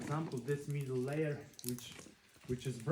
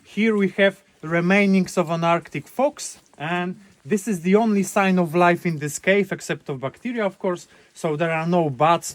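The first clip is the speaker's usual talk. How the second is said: tour guide projecting; 3 semitones higher, 16 dB louder than usual. Real, not acted. Tour guide addressing group loudly.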